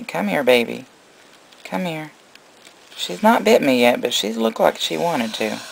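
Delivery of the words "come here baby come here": ordinary delivery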